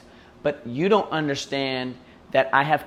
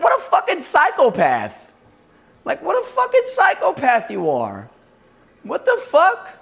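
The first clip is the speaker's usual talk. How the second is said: exasperated disbelief; 13 semitones higher, 4 dB louder than usual. Real, not acted. Exasperated outburst at strangers.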